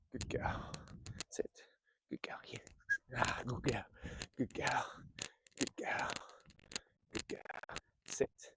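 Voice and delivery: soft whisper to the dog; hushed praise so she stays calm. Real, not acted.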